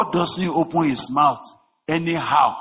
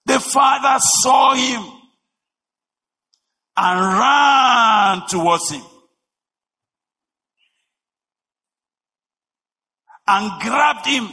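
high, loud, slow cry of "The father saw him"; shouted preaching; 10 semitones higher, 5 dB louder, slower than usual. Acted. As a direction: bellow it out to the congregation. Preacher repeats line, then shouts.

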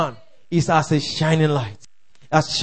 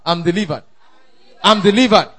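ordinary delivery

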